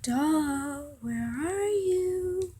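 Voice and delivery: slow, soft, rising drawn-out call; taunting search call. Acted. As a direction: draw it out softly like hide-and-seek. Captor seeking hidden girl.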